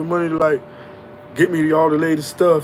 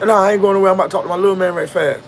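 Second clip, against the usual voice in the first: brushing off; 6 semitones higher, 3 dB louder than usual. Retold or imitated. Dealer brushing off his friend.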